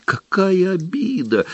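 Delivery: high, gasping house elf voice. Acted. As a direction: gasp it in a small high voice. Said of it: "задохнулся эльф".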